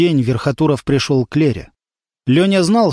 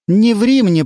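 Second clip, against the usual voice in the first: mock stern mother; 7 semitones higher, 3 dB louder, slower than usual. A narrator's reading. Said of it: "пошла в наступление мама".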